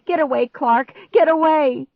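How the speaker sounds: high, loud, rising panic; urgent warning shout. A narrator's reading. Girl warns stepbrother off, shouting urgently. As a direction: yell him away from danger.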